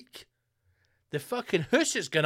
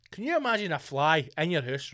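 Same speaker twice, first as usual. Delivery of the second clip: ordinary delivery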